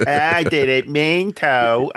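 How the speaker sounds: put-on high, slow babyish voice; baby doll voice. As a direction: whine it like an adult baby. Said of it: "the baby doll voice".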